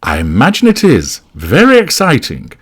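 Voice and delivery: forced enthusiasm, rising high at the end; mustered enthusiasm. A narrator's reading. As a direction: muster some keenness for show. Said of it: "mustering a degree of enthusiasm".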